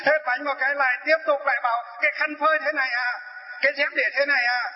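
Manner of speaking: mock scolding, pitched high and nagging; nagging roommate. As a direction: nag about towels and slippers like a fussy old woman.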